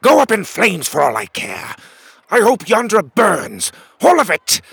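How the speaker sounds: high, loud royal tantrum; furious spitting. A narrator's reading. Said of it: "the king spat furiously as he spoke".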